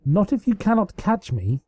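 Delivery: a lightly voiced mischievous taunt; playful taunt. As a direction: taunt her sister over your shoulder. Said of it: "Princess Luna taunted back".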